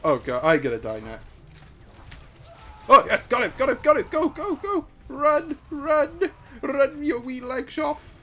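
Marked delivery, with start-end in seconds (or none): 2.9-8.2 high, loud, laughing cheers; gleeful cheering. Real, not acted. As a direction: egg them on while cracking up.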